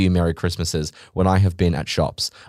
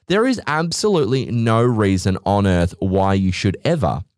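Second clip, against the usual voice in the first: normal talking voice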